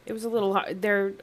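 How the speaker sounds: ordinary delivery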